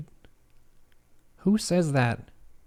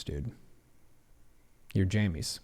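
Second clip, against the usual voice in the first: ordinary talk, nothing marked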